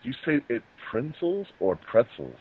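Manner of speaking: normal talking voice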